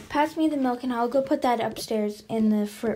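usual speaking voice

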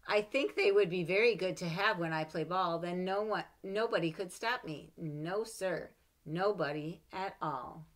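put-on lively read-aloud for children; story reading. A narrator's reading. Mother reading a picture book to her child, lively.

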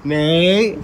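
calling out loudly to wake him. Real, not acted. Friend waking Nate up with a loud call. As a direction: raise the voice to rouse a sleeper.

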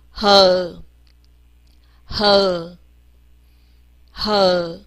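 和 as a low, breath-started "huh"; 字母发音示范. Shown as a demonstration. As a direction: draw out 和, pitch sinking. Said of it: "我们念一下和". (低而拉长地念“和”)

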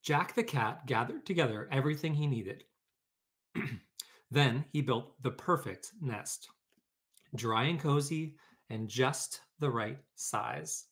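lively read-aloud storytelling voice; story narration. A narrator's reading. Teacher reading a picture book to her class, lively storytelling.

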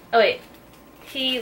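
ordinary talk, nothing marked

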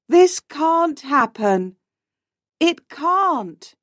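high-pitched, understated despair; distressed disbelief. A narrator's reading. Despairing over lost friend.

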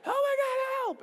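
very high, loud panicked cry; screaming kid. Retold or imitated. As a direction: yell for help, overdramatic. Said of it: "I'm screaming".

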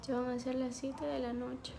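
ordinary delivery

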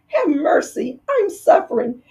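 high, tearful begging; weeping plea. Acted. Fox begging the dentist, hammed-up weeping. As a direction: plead through mock sobs. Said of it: "he wept so bitterly".

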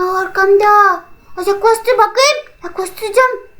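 usual speaking voice

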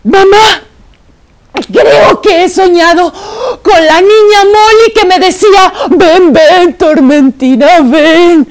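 high, loud tearful telling; high, loud upset little girl; scared crying child. Acted. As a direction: blurt out the nightmare in fright. Frightened child after nightmare.

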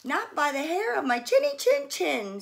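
a touch of a scared little pig; squealed. A narrator's reading. Frightened pig answering the wolf, mild squeal. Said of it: "squealed the panicked pig".

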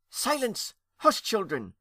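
high, sharp hissed command; hissed hush. A narrator's reading. Aunt quieting chattering young ghosts, hissing. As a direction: hiss for silence above the din. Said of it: "hissed Lady Esther above the din".

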